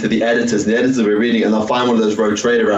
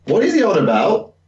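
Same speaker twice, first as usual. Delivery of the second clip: put-on confused young reader; 9 semitones higher, slower than usual. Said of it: "just like".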